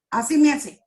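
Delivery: usual speaking voice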